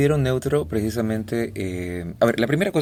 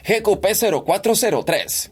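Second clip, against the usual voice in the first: higher character voice on the plate; another voice; 8 semitones higher than usual. Shown as a demonstration. Same plate repeated in a different, higher character voice. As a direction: try a new higher voice for the plate. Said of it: "Hago cualquier voz".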